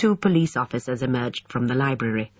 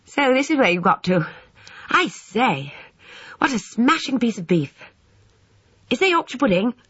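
put-on high, excited boy's voice; eager schoolboy; 10 semitones higher than usual. A narrator's reading. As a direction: pipe up eagerly like a hungry schoolboy. Voices Alexander, an eager boy.

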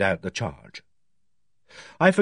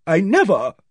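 raised, louder denial cut short; indignant protest; 8 semitones higher, 6 dB louder than usual. A narrator's reading. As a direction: burst out in protest, higher and louder. Accused woman protests, is cut off.

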